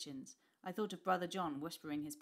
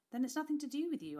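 relieved; 9 semitones higher, 6 dB louder than usual. A narrator's reading. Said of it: "I said, relieved".

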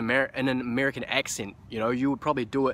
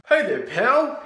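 hammed-up greeting; 10 semitones higher, 6 dB louder, much slower than usual. Hammed greeting opening a daily video.

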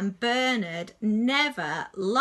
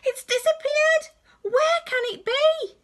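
crying out; 6 dB louder than usual. Acted. Robot Bernard discovering his bottom is gone, loud wail. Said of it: "cried Bernard".

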